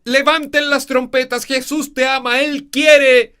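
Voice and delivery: everything shouted high and loud; relentless loud shouting; same loud volume. Shown as a demonstration. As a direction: blast every line the same way. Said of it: "Siempre hablando con el mismo volumen".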